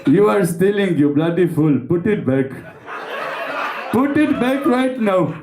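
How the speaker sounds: slow, mock-menacing Indian accent; lightly put-on Indian accent, trying to sound threatening. Indian Batman confronting drug thieves, mock Indian accent. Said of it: "cum poți să fii amenințător cu un accent indian".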